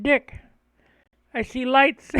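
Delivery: a hint of a worried neighbor calling out; neighbor's voice. Neighbor telling dad about lights, lightly voiced. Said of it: "he said".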